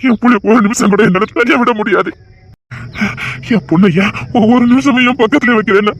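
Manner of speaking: normal talking voice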